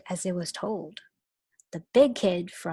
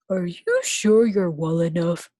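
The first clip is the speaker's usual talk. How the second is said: louder fussy principal; 6 dB louder than usual. Acted. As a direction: play the prim principal. Voices the principal.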